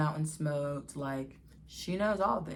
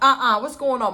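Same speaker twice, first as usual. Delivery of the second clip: loud, high mock mom demand; much louder, higher suspicious mother; strict mom; 8 semitones higher, 12 dB louder than usual. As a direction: call them out like a strict mother.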